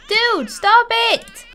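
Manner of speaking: slow, loud, high stop it; exasperated shout. Real, not acted. Frustrated player yells at the misbehaving cat, loud and slow. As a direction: yell at the cat to quit.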